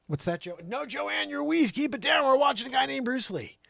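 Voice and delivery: high, loud scolding hush; loud shush. Acted. Shushing Joanne's wheeze during Bruce Lee, high and loud. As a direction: tell her to keep her wheeze down, loudly.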